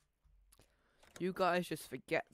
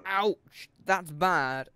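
much louder, hammed-up outcry; loud mock ouch; 11 dB louder, much slower than usual. Gamer reacting to a bad score, loud hammed-up ouch. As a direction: blurt it out loudly for comic effect. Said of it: "Sorry, I just had to do that".

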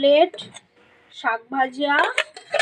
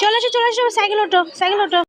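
calling out; 8 semitones higher, faster than usual. Real, not acted. Calling kids onto the cycle.

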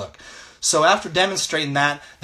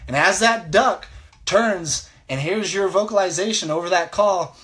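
ordinary delivery

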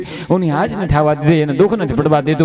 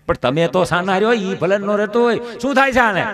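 usual speaking voice

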